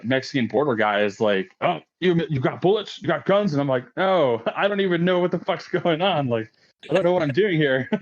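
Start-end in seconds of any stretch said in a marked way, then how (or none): 1.6-3.5 higher-pitched, questioning border guard; urgent mock interrogation, pitched up; imitated border guard. Mexican border guard questions a uniformed Marine, urgent questioning. 4.0-7.9 higher-pitched, laughing bewilderment; mock panic. Young Marine answers the border guard, mock panic.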